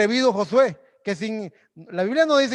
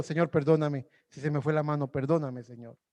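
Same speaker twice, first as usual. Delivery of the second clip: soft, lower, contrite plea; humble prayer; 5 semitones lower, 7 dB quieter than usual. Retold or imitated. Preacher softly asks God's pardon after fighting in prayer. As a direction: beg forgiveness softly.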